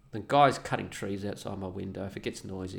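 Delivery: ordinary talk, nothing marked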